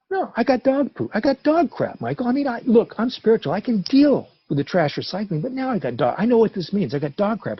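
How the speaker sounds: venting outrage about the dog poop; agitated complaint. Retold or imitated. Venting to sponsor Michael about dog poop, high and indignant. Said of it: "I'm like".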